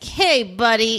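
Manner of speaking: a hammed-up overprotective brother; mock twins' voice. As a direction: growl it like the twin guarding his sister. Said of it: "the twins being all like".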